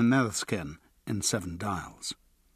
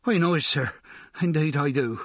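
higher, louder eager-to-please cockney voice; a sweating informant hurrying to agree; Choky Bill, nervous informant; 4 semitones higher, 3 dB louder than usual. A narrator's reading. Informant Bill answering the Yard man, higher and eager.

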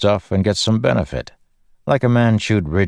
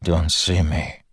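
croaked; slower than usual. Acted. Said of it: "he croaked".

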